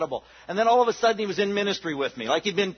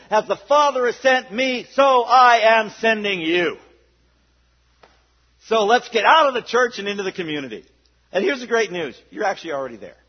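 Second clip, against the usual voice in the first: preaching at full force; 2 semitones higher, 7 dB louder than usual. Real, not acted. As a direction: send them out with fire. Closing charge to congregation.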